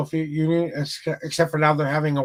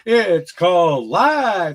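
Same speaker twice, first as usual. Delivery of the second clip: very slow, louder reveal; dramatic punchline; 6 dB louder, much slower than usual. Acted. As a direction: land the reveal with weight. Punchline of motivational spiel.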